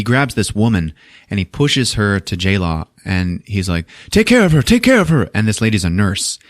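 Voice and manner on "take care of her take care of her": high urgent pleading voice; frantic plea. Retold or imitated. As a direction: push her off on someone, begging. Man pushing woman at J-Law, pleading.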